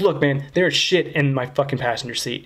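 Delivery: normal talking voice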